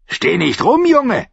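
high, loud angry snap; scolding innkeeper, pitched right up; snapping. A narrator's reading. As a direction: snap at someone in the way. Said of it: "fauchte sie".

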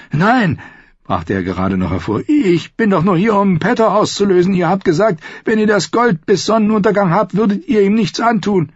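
high, loud frightened protest; begging for his life; panicked young lord pleading; desperate plea. Acted. Merritt panicking.

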